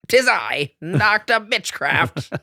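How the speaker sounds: slow, grand villain announcement. Acted. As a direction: announce yourself like a supervillain. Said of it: "the voice in my head is".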